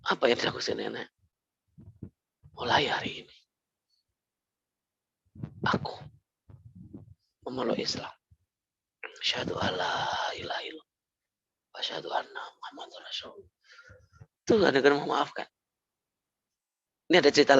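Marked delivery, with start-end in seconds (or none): 2.6-13.5 hushed, tearful whispered creed; tearful confession. Retold or imitated. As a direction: whisper the shahada through tears. Old woman converts in tears.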